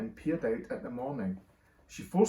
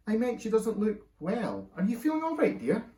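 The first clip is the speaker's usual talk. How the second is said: concerned father; 9 semitones higher, 3 dB louder than usual. A narrator's reading. Husband asking his unwell wife, concerned and high. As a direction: fuss gently over her, pitched up.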